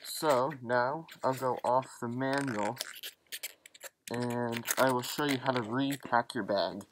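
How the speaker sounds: muffled behind mask voicemitter; speaking inside sealed respirator; through a gas mask. Real, not acted. Seen 4: Gas mask clearly worn over face during all labelled frames.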